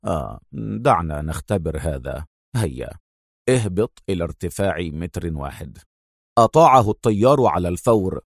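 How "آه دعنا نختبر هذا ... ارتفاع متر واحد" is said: low, soft and unhurried; calmly in control, giving an order; quiet calm command. A narrator's reading. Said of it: "قال أدهم في هدوء".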